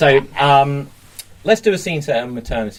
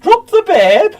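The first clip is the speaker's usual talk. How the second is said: high nurse voice; 7 dB louder than usual. Acted. Nurse voice in scene.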